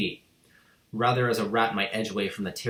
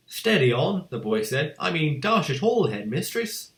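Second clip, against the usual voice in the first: put-on higher, mildly protesting boy; slightly raised protest; boy's voice; 6 semitones higher, 3 dB louder than usual. A narrator's reading. Boy answering the headmistress, mild protest.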